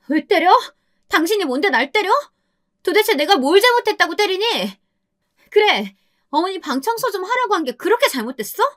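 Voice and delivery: high, furious screaming; screaming wife. Acted. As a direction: shriek back at him full of spite. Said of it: "악에 받쳐 고래고래 소리를 질렀습니다". (악에 받쳐 고래고래 소리 지르는 아내)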